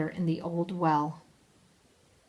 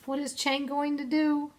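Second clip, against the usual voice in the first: usual speaking voice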